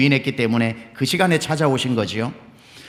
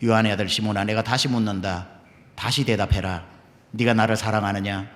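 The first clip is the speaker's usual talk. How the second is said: voice of the Lord; 6 semitones lower, 4 dB quieter than usual. Acted. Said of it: "세 번째 또 물으십니다".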